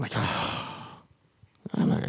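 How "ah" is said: voiceless, breathy gasp; retold gasp of dread; dismayed gasp. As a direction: let out a soft, airy gasp. Recoils at the inner voice's order.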